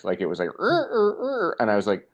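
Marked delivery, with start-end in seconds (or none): 0.6-1.6 high-pitched creaking imitation of a gate; rusty gate creak. Skateboarder mimics the arm's rusty gate sound, high creaking. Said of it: "a rusty gate".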